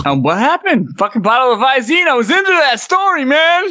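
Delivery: high, whining protest at being cut off; dismayed outburst. Real, not acted. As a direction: cry out in frustrated disbelief. Voicemail story suddenly cut off.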